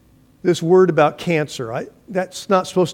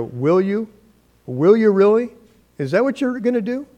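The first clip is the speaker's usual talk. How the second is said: higher, mocking parent asking "Will you really?"; doubtful parent; 5 semitones higher than usual. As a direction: tease the child's big promise. Parent mocking child's boast.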